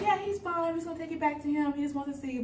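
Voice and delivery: lightly mocking, reassuring doctor voice; imagined doctor. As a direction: play the breezy doctor with good news. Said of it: "the doctor would have said".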